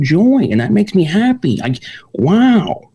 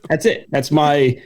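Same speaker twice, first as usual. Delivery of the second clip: ordinary talk, nothing marked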